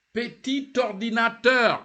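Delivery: PETIT ORDINATEUR loud and high, final T linked; liaison. Shown as a demonstration. Teacher presents PETIT ORDINATEUR to learners, liaison. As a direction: sound the T of PETIT into ORDINATEUR, projected. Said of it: "You join".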